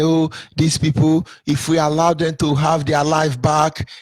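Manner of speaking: slow, level, measured recitation; imitated plotting. Oppressors scheming to keep Biafrans down, slow imitated plotting. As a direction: intone each step like a deliberate plan, acted.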